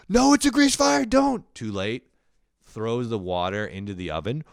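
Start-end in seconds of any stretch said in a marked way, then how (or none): 0.1-1.4 high, loud shouted warning; frantic warning. Retold or imitated. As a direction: yell to stop him in time. Roommate yelling warning before throw.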